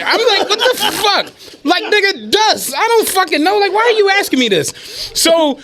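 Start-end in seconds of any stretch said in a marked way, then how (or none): 0.5-4.7 higher, laughing disbelief; incredulous, laughing through it; exasperated disbelief. Retold or imitated. [name] retelling his reply to the dust question, exasperated and laughing.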